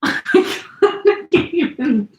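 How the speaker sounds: high-pitched words drawn out through laughter; helpless laughter. Real, not acted. Teacher derailed by cat climbing on her, laughing helplessly. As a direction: crack up while trying to speak. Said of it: "get through this without laughing".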